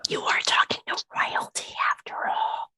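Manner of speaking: stage whisper to the noble; whispered aside. Acted. Poppy whispers a warning to the noble about royalty. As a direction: whisper to the side, unvoiced. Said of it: "I'm like".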